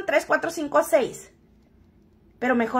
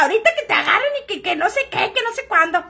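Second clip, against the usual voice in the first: imitating her friend; 8 semitones higher, faster than usual. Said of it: "me dice mi amiga".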